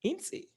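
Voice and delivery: "Incy" starting very high, then falling; stressed high tone. Shown as a demonstration. Linguist repeating the word for my dog, high first syllable. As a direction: pitch "In" way up, drop on "cy". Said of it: "it's stressed and high tone".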